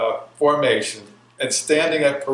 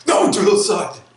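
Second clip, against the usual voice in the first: shouted "No, drill sergeant" at a higher pitch; shouted answer; 8 semitones higher, 5 dB louder than usual. Retold or imitated. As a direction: bark it back like a recruit. Recruit answers again, louder.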